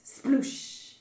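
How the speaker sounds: unvoiced-style whispered "sploosh"; imitated splash. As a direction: hush out a splashing noise.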